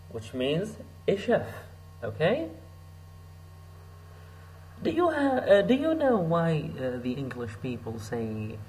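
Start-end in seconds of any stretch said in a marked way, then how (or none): none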